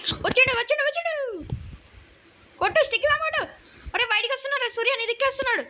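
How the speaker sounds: high-pitched urgent calls; excited urging. Real, not acted. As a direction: hurry your teammate, pitch up. Enemy arrives, urging teammate.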